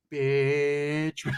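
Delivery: long drawn-out taunt; mocking payback. Storyteller to the knocked-out boy, long mocking drawl. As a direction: hold the vowel long, savouring it.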